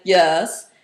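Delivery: slow, loud, low "yes". Shown as a demonstration. Students answering the teacher's low call, low tone. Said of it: "that's how you want your students to respond".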